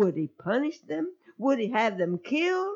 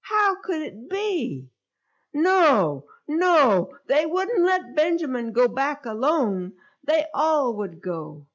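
higher and louder, dismayed storyteller; alarmed exclaim; 6 semitones higher, 6 dB louder than usual. A narrator's reading. Narrator telling children of the brothers' dismay, higher and louder. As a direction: gasp out the shock of the cup being found. Cup found in Benjamin's bag.

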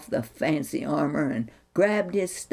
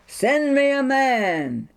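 slow, louder and higher demand; roaring giant; 7 semitones higher, 4 dB louder, slower than usual. A narrator's reading. Giant daring Israel to send a fighter, slow roar. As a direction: drag out the dare like a bellow. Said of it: "he roared".

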